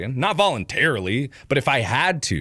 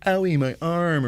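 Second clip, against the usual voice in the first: higher mock whimper; whiny patient; 6 semitones higher than usual. Minor patient behind curtain, high mock whine. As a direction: whine about a minor hurt.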